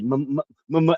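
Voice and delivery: stuck on the first sound, repeating m-; imitating his stutter. Boy stuttering while trying to talk to his mom, mock stutter. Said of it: "I had a bad stutter".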